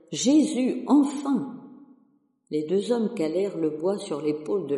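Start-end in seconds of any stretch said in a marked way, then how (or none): 0.1-1.6 high, louder cry of relief; relieved exclamation. Acted. Curé sees the cross arrive, crying out in relief. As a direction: shout it out as the cross finally arrives. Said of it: "le curé s'écria".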